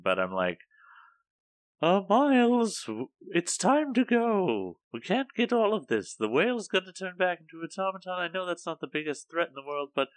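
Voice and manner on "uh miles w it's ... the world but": high, wobbly, tipsy posh cook voice; drunk Julia Childs. Acted. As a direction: play it like a tipsy TV chef. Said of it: "You sound like a very drunk Julia Childs".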